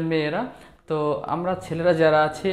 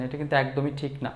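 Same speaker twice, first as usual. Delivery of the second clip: ordinary delivery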